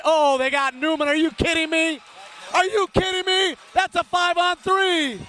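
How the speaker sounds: impersonating a fellow broadcaster losing it over the call; Jimmy Vitale impression. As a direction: build from shock to outrage, pitch climbing. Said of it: "That's my best Jimmy Vitale imitation".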